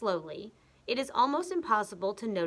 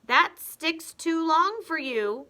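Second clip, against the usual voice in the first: higher, louder, slow mocking husband; nasty old husband teasing his wife; sly Mr Twit; 9 semitones higher, 7 dB louder, slower than usual. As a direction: needle her slowly with fake concern.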